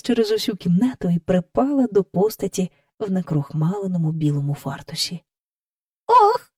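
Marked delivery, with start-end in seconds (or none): 6.1-6.5 high-pitched acted sob of "oh"; sobbing child. A narrator's reading. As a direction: let out a very high tearful cry. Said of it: "хлипала вона".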